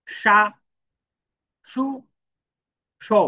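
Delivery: সা, সু, সো read as sha, shu, sho with a pause after each; slow teacher model of সা সু সো; careful syllable reading. Shown as a demonstration. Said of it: "একটু খেয়াল করে পড়বেন".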